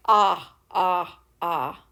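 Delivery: repeated "ah" for short O; short o sound. Shown as a demonstration. Teacher to kids, demoing short O. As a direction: sound out "ah" three times. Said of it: "What does the short O sound say?".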